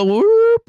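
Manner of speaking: loud, very high whoop; surprised whoop. Real, not acted. Streamer watching the price chart drop, startled whoop. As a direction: let out a sudden high "whoop".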